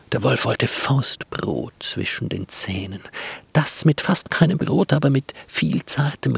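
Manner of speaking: ordinary delivery, nothing marked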